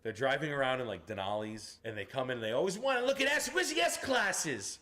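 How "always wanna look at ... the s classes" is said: high, stretched-out loud tough-guy yell; loud mobster. Retold or imitated. Mobsters demanding the S-classes in the showroom, loud and high. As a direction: imitate blustering Italian wannabe mobsters, loud and high. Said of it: "loud as shit".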